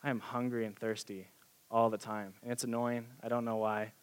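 low, subdued grumble of pretend frustration; mock frustrated. Pretending to complain to the worship leader of hunger, mock frustrated. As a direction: play at being fed up, softly and low. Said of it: "I'm really frustrated".

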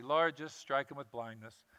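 soft, offhand, unbothered request; calm as can be. Acted. Elisha casually asks God amid the armies: soft offhand calm. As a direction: toss the prayer off calmly. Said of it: "he's just calm as can be".

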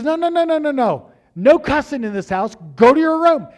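high, loud stern scolding; dad scolding the son for cussing, loud. Retold or imitated. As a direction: lay down the house rule.

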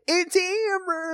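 high, stretched-out squeal; mock excited cry. Co-host echoing the Simpsons episode quote, high drawn-out cry. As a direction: echo the cartoon cry, long and high.

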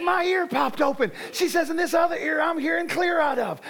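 healed woman crying out with joy; overjoyed. Retold or imitated. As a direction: call it out in amazed delight. Healed woman tells she can hear.

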